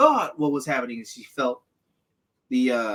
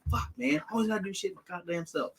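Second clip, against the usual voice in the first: muttered mock complaint, higher and much softer; grumbling aside; 5 semitones higher, 12 dB quieter, faster than usual. Friend voicing Finn muttering to himself, a soft mock grumble. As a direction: grumble it under your breath like a put-upon hero. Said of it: "I'm like".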